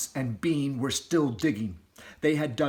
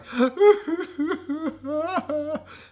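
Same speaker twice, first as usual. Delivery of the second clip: weeping; 5 dB louder than usual. Acted. Mother fox begins to cry.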